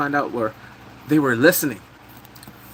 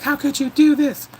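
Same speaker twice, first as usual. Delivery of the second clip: high, louder mock cry; queen's accusation; 12 semitones higher, 4 dB louder than usual. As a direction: play the betrayed queen wailing. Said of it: "She's running right there like".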